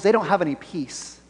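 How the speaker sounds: ordinary talk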